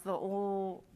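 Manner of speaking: l held as a clear liquid sound. Shown as a demonstration. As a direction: let the l slide in the mouth. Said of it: "So these two sounds".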